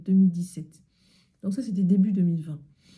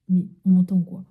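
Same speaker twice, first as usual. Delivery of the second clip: ordinary delivery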